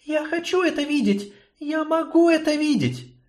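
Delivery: high, entranced insistence; delirious girl. Acted. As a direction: plead as if in a fever. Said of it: "словно в бреду".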